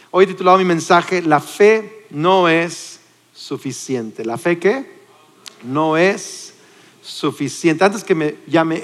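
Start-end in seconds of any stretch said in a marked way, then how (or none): 1.2-4.2 very slow, each word weighed; slow title announcement. Shown as a demonstration. (anuncio lento y solemne del título)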